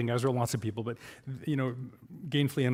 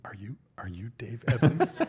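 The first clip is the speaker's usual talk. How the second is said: slow whispered question, half-laughing; imitating a fan's whisper; 4 semitones lower, slower than usual. Fan sidles up and whispers his name in question. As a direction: lean in and whisper it, a bit awed. Fan approaching him, retold.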